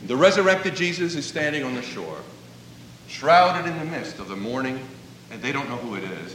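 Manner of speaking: ordinary delivery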